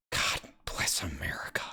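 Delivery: exasperated whisper under the breath; whispered aside. Real, not acted. Reader struggling through dialect, whispered aside. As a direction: drop to a voiceless, hushed aside.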